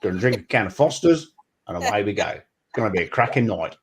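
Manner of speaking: hammed-up Aussie twang. Demonstrating Australian for friends, broad mock Australian accent. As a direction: mimic a cliché Australian planning a night. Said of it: "So that's Australian".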